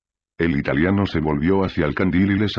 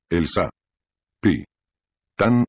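"El Sa. Pi. Tan" split into separate, stressed syllables; each syllable of "Sa. Pi. Tan" hit apart, drily; ironic syllable stress. A narrator's reading. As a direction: space out "Sa", "Pi", "Tan" with mocking irony. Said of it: "remarcando cada sílaba con ironía".

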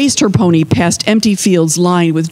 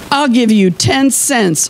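slow, higher-pitched offer; raised voice, measured words; farmer calling a bid; 6 semitones higher than usual. A narrator's reading. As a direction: call out your bid deliberately.

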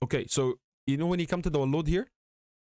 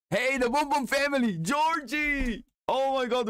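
excited; 9 semitones higher, slower than usual. Real, not acted. Excited at the design.

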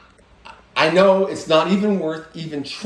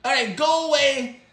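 cocky pet voice, higher, with mock shouts; voiced pet ranting; 9 semitones higher than usual. Pet Snarfles insulting his owner, bratty ranting creature voice. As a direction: throw a bratty pet tantrum.

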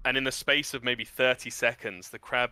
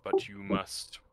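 deep rumbling voice; 3 semitones lower, 4 dB quieter than usual. Acted. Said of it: "and just says".

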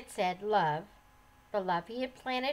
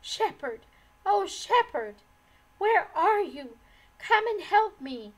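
much higher, louder desperate cry for help; fearful pleading call; 9 semitones higher, 9 dB louder than usual. A narrator's reading. Woman surrounded by threatening men crying for the shepherd, fearful. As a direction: call out in terror, begging. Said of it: "calling out fearfully and pleadingly".

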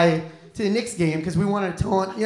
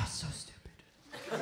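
slow, breathy whispered self-reproach; muttering at yourself in bed, whispered; self-loathing whisper; 4 semitones lower, 20 dB quieter, much slower than usual. Retold or imitated. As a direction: hiss it at yourself, disgusted. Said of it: "I spend an hour just like this".

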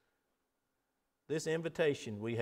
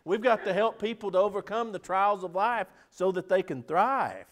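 raised, ringing preacher's exhortation; preaching at full force; 5 semitones higher, 7 dB louder than usual. Acted. Preacher rallying his congregation, full-force preaching. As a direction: drive the sermon home to the pews.

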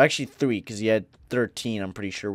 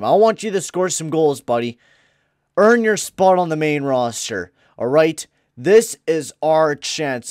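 louder pumped-up coach talk; rallying pep talk; 5 semitones higher, 9 dB louder than usual. Acted. Coach rallying DeBrincat before the big game, raised voice. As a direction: fire the player up, voice raised.

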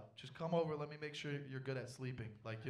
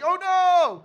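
loud high wail of dismay; panicked cry; 21 dB louder than usual. Retold or imitated. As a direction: cry out in horror. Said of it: "in my head, I was like".

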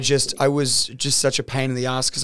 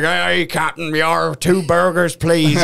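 silly speaker voice; 3 semitones higher, 4 dB louder, slower than usual. Retold or imitated. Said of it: "noises and voices in the speakers".

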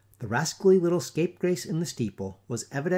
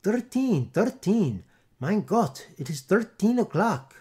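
put-on high, loud Dutch-accented panic; panicked alarm; 7 semitones higher, 4 dB louder, slower than usual. A narrator's reading. Townsfolk crying out at thirteen o'clock, high panic. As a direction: cry it out in horror.

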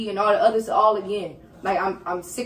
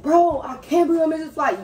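retold yell; 5 semitones higher than usual.

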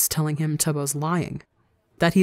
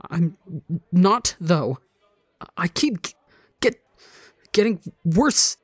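stammering through a sob; sobbing. Acted. Ranbu sobbing as he denies he's getting better. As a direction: break and stutter as the tears come. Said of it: "can't stop the sob".

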